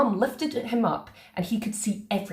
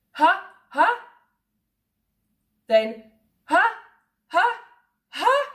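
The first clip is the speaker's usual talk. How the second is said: rising ha ha ha building higher; trying to sneeze; 7 semitones higher, 4 dB louder, slower than usual. A narrator's reading. Sam straining to sneeze for the Queen. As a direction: reach for a sneeze that won't come.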